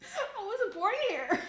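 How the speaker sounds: high, loud, drawn-out protest with laughter; exclaimed protest. Retold or imitated. Streamer answering people who say she's clearly Canadian, high protest. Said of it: "I'm just like".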